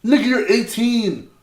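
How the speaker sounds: ordinary talk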